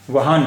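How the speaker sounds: वहान closed by a sounded n; full final n. Shown as a demonstration. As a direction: end वहान firmly on the consonant n. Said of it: "होता फिर उच्चारण".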